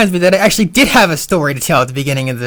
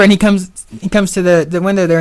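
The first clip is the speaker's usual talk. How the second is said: normal talking voice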